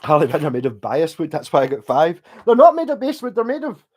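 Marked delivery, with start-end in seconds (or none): 2.5-3.8 mock indignant. Mock rant about guitar wood.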